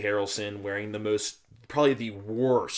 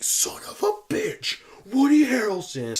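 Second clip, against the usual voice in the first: high, drawn-out whispery exclamation; mock amazement in a strained, slow stage whisper; imitating astonished moviegoer; 13 semitones higher, 4 dB louder, slower than usual. Imitating astonished moviegoer behind him.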